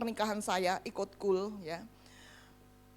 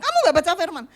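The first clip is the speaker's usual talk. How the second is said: mild scolding; 5 semitones higher, 8 dB louder, much faster than usual. Retold or imitated. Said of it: "Langsung dimarahin".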